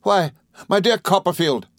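high, eager, astonished gentleman; excitable friend. A narrator's reading. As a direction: exclaim to a dear old friend.

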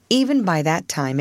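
ordinary delivery

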